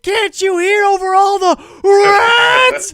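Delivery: high, slow, strained yell over imagined noise; frantic shout. Retold or imitated. Man with hands over his ears shouts at servant, high and frantic. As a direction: yell as if deafened by rats. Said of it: "jamming his hands into his ears".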